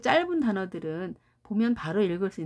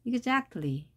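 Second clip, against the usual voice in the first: exactly said as eager agreement, pitched up; agreeing exactly; 5 semitones higher, much slower than usual. Shown as a demonstration. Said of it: "맞장구 쳐줄 때".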